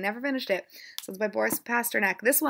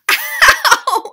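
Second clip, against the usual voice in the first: pained yelp; 17 dB louder than usual. Real, not acted. Cat bites her.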